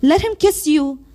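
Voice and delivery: insistent, rhythmic recital with pauses; spoken-word poem. Acted. Reciting a favorite poet's Unrequited Love, spoken-word delivery.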